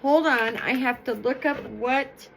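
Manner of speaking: usual speaking voice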